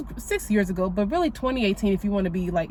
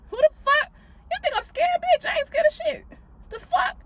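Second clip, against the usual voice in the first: much higher, mock-tough taunt; defiant Lilith energy. Taunting a spirit as Mama Lilith, mock defiance. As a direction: dare the spirit to try her. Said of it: "Mama Lilith energy".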